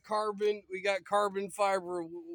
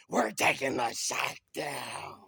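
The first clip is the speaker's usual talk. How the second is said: crazy mean voice; 6 semitones lower than usual. Shown as a demonstration. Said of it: "No, go like this".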